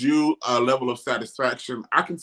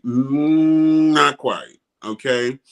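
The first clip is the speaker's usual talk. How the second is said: normal talking voice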